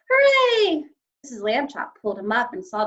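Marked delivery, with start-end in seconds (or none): 0.1-0.8 high, loud joyful cheer. A narrator's reading. Stanley cheers after finding the ring. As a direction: cry out in triumph from the shaft. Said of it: "Stanley cried out".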